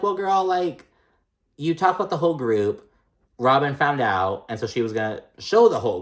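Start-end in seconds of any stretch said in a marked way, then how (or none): 0.0-2.9 higher, put-on sassy voice; sassy retort. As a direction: say it like an obvious truth. Speaker retorts on Robin's behalf.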